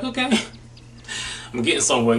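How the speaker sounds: quick, laughing self-congratulation; re-enacted happy reaction; pleased chuckle. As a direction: chuckle through it, pleased with yourself. Acting out pleased reaction to progress.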